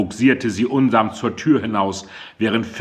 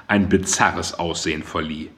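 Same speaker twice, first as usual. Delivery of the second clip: ordinary delivery, nothing marked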